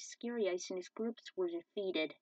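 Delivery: plain reading voice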